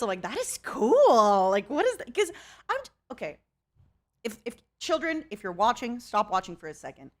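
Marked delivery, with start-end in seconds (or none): 0.2-2.1 drawn-out, louder enthusiastic reaction; really into it. Retold or imitated. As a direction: gush as if totally impressed. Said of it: "into it at first".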